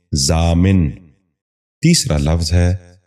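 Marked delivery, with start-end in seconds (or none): 0.1-0.9 ضامن ending in "-min", "zaa-min"; correct pronunciation. Shown as a demonstration. Teacher giving viewers the model, correct pronunciation. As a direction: say ضامن as zaamin. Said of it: "غور سے سنیے".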